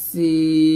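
"সিন" with ee held very long; loud, sustained "সিন", late n; long-held seen. Shown as a demonstration. Teacher models four-count stretch, long seen. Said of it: "চার টান দিতে হবে".

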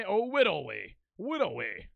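put-on lightly lisped "widdly" with w for r, babyish; a hint of mock baby voice; mock baby talk. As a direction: tease chat by saying "widdly" like a toddler. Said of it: "I didn't say it like that".

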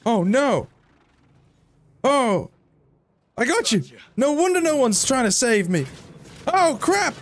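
real panic mid-fight, voice shooting up; flustered yelps of alarm; panicked high cries. Real, not acted. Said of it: "that little screaming fit".

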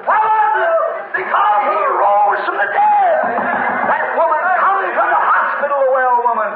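high, fervent preacher's cry; full-force preaching. Real, not acted. Preacher telling the congregation of a woman's recovery, exultant.

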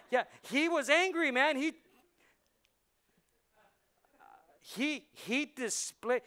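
high, loud, fast preacher's build about Jesus' anger; preaching at full force. Acted. Pastor firing up the church about Jesus' anger, preaching loud. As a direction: lift the room, preach it hard.

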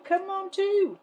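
high excited urging; yelling at a horse race. Real, not acted. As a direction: cheer the dice on. Urging the dice roll.

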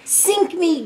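high exasperated groan. A narrator's reading. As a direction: groan in pirate dismay. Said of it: "groaned Jake".

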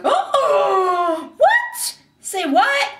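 high squealed disbelief; shrieky hammed-up shock, rising at the end; mock amazement. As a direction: act blown away by a plot twist. Demonstrates amazement at groundbreaking twist.

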